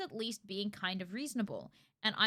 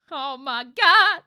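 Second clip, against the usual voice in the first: very loud, slow, stretched cry; overwhelmed; 4 semitones higher, 16 dB louder, much slower than usual. Real, not acted. Streamer overwhelmed by the sweet father-daughter scene, loud and stretched. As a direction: let it burst out long and loud.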